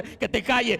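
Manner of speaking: loud, high order to hush; shouted rebuke. Acted. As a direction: shout him down forcefully. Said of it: "le decían".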